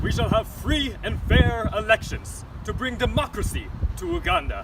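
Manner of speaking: dictator voice. Acted. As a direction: proclaim it to the crowd. Dictator promising elections.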